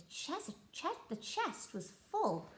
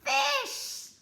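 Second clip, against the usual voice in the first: triumphant answer; 5 dB louder than usual. Acted. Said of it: "said the pirate chef".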